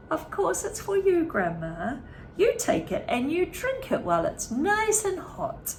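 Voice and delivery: high, falsely sweet coaxing; sweet smiling father. A narrator's reading. Mister Cranky sweetly urging Grandma to drink, high and sugary. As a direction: coax her sweetly with a smile. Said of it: "he smiled sweetly".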